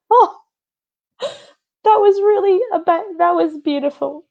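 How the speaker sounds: high-pitched, mock teary gasp of wonder; imitated tearful awe. Moved by something she looks at, mock tearful voice. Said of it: "I don't even know why I'm crying".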